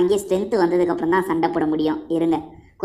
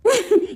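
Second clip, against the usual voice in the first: high burst of laughter; 12 semitones higher than usual. Real, not acted. Laughs at the game.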